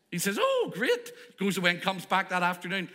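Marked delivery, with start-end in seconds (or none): 0.4-1.1 high, gleeful oh great; thrilled at the free treat; delighted. Acted. Ben reacts gleefully when offered anything he wants.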